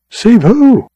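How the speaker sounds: high, loud startled question; surprised. Acted. Josefa startled by the cowboy's story, high and loud. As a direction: jump up in disbelief.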